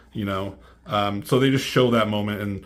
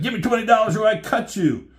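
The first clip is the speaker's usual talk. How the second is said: much higher and louder, a put-on squeaky threat; cartoon mugger voice; 12 semitones higher, 6 dB louder than usual. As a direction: ham up a silly street robber. Homeless guy mugging George.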